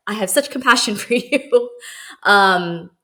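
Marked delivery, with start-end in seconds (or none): 0.1-1.7 mock sweet, laughing. Mock compassion toward homeless people.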